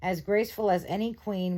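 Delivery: usual speaking voice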